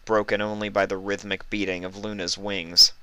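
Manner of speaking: usual speaking voice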